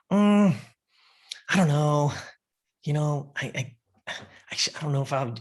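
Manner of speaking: imitating an unsure client, halting; retold hesitation. Client unsure what her friend wore, hesitant. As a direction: stall with a drawn hum, then falter.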